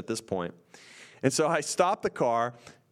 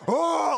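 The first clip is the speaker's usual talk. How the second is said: high, loud imitated holler; imitated wild yell; 5 dB louder than usual. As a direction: holler like a crazed man shoving. Imitates man heaving the car.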